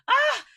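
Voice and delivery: a sudden high, loud squeal of emotion; emotional squeal. Real, not acted. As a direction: let a shriek burst out as the memory floods back. Overwhelmed recalling her win.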